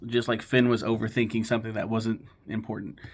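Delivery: normal talking voice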